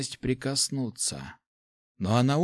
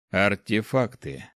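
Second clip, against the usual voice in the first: ordinary delivery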